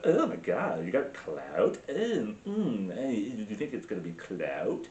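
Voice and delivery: hammed-up Valley Girl mumble; mock Valley Girl. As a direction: imitate an annoying Valley Girl. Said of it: "a Valley Girl".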